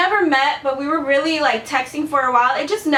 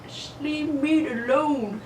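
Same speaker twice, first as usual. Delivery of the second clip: slow, softened, drawn-out plea; weary exasperation; 6 dB quieter, much slower than usual. Retold or imitated. Woman to a man who rarely texts, slow weary exasperation. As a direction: drag each word out, quietly fed up. Fed-up plea to an unreliable texter.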